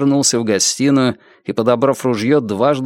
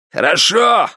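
booming voice; 7 semitones higher, slower than usual. A narrator's reading. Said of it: "прогремел тот самый голос".

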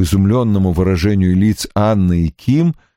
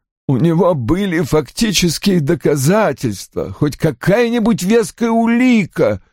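put-on high-pitched, emphatic older father; Roy's agitated character voice; 10 semitones higher than usual. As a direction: play an indignant father, pitched well up.

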